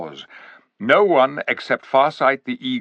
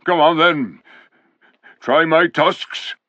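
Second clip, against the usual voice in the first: slow, higher beast voice daring them; defiant boar; 5 semitones higher, 4 dB louder, slower than usual. Acted. Boar daring the soldiers, slow and defiant. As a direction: taunt like a cornered boar.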